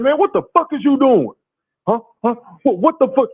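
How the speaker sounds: high-pitched, indignant challenge; retold confrontation. Retells confronting the man outside.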